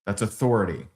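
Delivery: usual speaking voice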